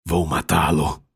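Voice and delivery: low, contained vow; grim resolve. A narrator's reading. Devastated father resolving to kill his daughter's attacker, low grim vow.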